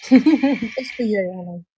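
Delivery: slow, breathy and flirty, through a laugh; drawn-out mock flirt for Greg; breathy tease. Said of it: "The breathy voice with the darling".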